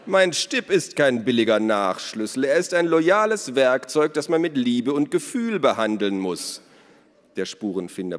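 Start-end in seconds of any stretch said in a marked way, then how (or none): 0.0-6.6 loud, high-pitched outraged defence; offended and emphatic, pitch raised; indignant. A narrator's reading. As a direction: defend it with wounded pride. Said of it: "sagte Elos empört".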